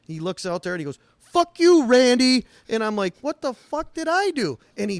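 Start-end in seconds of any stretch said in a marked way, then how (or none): 1.2-2.4 much higher and louder, a furious parting shout; hammed-up retold outburst at a coworker; imitating angry yell. Retells kid yelling at him. 3.2-4.6 higher and slow, incredulous; mock bewildered protest; baffled outburst. Retells his own confused reaction.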